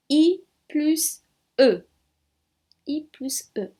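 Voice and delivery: "I plus E" slowly and clearly; slow spelling. Shown as a demonstration. Teacher spells "I plus E" slowly for class. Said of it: "o meu som vai ser de I".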